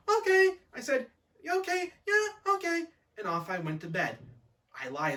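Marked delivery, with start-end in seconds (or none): 0.1-0.5 put-on high, loud childlike okay; eager agreement. Child agreeing too readily. 1.5-2.8 put-on high-pitched childlike assent; overly obliging, innocent little voice; eager agreement. Child agreeing too readily.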